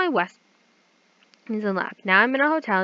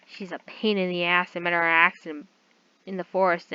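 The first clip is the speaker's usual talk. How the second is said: ordinary talk, nothing marked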